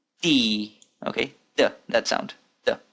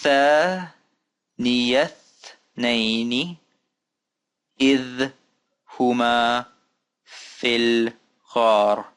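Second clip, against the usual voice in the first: long held syllables with pauses between; slow careful recitation; slower than usual. Shown as a demonstration. Teacher reading another example for learners, slow and spaced. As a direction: read it out very slowly, word by word.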